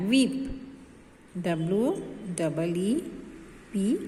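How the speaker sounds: usual speaking voice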